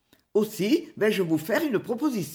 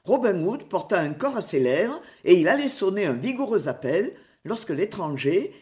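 usual speaking voice

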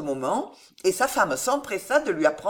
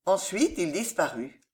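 ordinary delivery

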